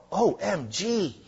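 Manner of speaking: slow, high, drawn-out O M G; mock OMG. Preacher mocking a flippant OMG, slow and high. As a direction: spell it out like a gushing teen.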